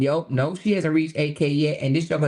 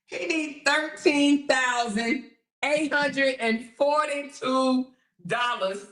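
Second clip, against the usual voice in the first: high and slow, spelling out the number; high, slow, savoring the total; drawn-out disbelief; 7 semitones higher, slower than usual. Real, not acted. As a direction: stretch every word of the figure in amused disbelief. Reveals figure, cracking up.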